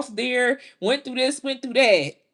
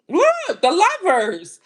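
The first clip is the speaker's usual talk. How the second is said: excited; 6 semitones higher, 4 dB louder than usual. Real, not acted. Card reveal, sudden exclamation.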